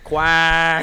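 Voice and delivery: loud imitated quack; joking, drawn-out duck call. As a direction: honk it out like a duck.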